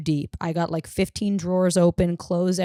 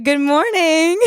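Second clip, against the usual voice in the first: high, sweetly flattered "Good morning"; imitating her own smug sweet reply, pitched high; mock flattered reply; 10 semitones higher, 4 dB louder, slower than usual. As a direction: answer as if the compliment was for you. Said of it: "I was like".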